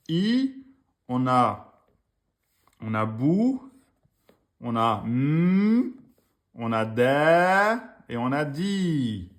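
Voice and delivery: very slow, each sound stretched; slow spelled syllables. Shown as a demonstration. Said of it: "slow syllable demonstration".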